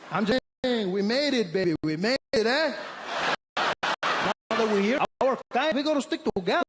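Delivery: put-on high, loud Mexican-accented English; Mexican guy. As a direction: sell the brotherhood with pride. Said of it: "He said".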